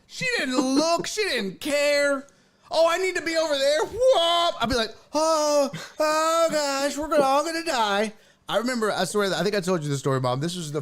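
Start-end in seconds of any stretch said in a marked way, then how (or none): 2.7-3.8 high, quick and airy; mock blithe aunt. Aunt changes lanes without looking, mock blithe. Aunt's casual reckless lane change. 3.8-4.5 imitated swerving noise; high swerve whoop. Aunt yanks the car across lanes, high whoop. 5.1-8.1 high-pitched comic dread; mock panic. Niece riding with reckless aunt, mock panic.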